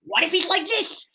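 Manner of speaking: high excited nerd voice; dorky character. Shown as a demonstration. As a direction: play the dorky excited guy. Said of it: "you know, and".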